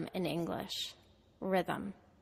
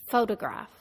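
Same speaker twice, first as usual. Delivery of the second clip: Photograph with PHO strong, middle syllable reduced; stress on first syllable. Shown as a demonstration. Teacher models a word for students, stress on first syllable. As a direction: hit PHO in Photograph, lighter GRAPH. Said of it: "The first syllable is stressed".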